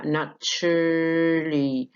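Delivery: "naturally" slowly as "natch-lee", drawn out; dropped A. Shown as a demonstration. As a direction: leave the A out of "naturally", stretching each part. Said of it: "ال A سقطت".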